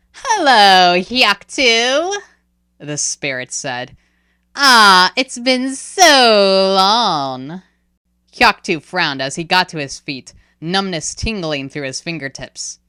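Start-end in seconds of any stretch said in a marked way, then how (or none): 0.1-7.6 spirit cat. Acted. Creepy spirit cat greets Hyaktu.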